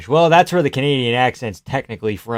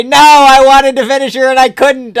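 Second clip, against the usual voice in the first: mock fighter; 10 semitones higher, 9 dB louder than usual. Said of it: "Why don't you just say".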